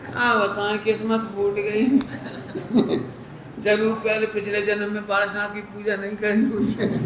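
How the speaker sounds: chuckling through the words; laughing. Real, not acted. Preacher laughing at his own imitation for listeners. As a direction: laugh while finishing the joke.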